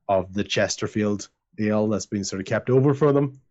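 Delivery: normal talking voice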